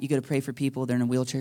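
usual speaking voice

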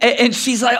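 ordinary talk, nothing marked

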